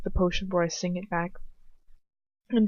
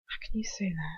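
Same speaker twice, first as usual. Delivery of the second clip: stunned whisper; 8 dB quieter than usual. Acted.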